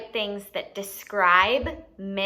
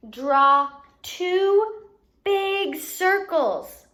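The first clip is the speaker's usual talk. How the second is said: each word drawn out, louder; slow emphatic instruction; 7 semitones higher, 5 dB louder, much slower than usual. Shown as a demonstration. Teacher slowly stressing the drawing instruction. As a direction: spell it out slowly for little kids.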